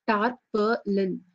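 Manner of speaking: "tarpaulin" said very slowly, its three syllables spaced out. Shown as a demonstration. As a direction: stretch out "tarpaulin" for learners. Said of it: "Three syllables means".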